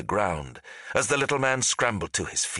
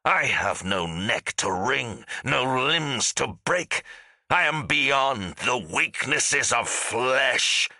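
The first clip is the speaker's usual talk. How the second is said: slow, high, snarling boast; 5 semitones higher, slower than usual. A narrator's reading. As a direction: draw each word out in a high, menacing snarl. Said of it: "Faris snarled".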